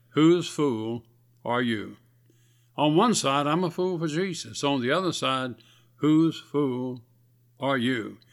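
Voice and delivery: normal talking voice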